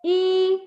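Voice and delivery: E high, level and stretched; first tone. Shown as a demonstration. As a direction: hold E steady on a high note. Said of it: "this is the first tone".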